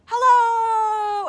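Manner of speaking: high, loud call; showing children how to call out; shouting. Shown as a demonstration. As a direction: shout it to make an echo. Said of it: "when you say something like".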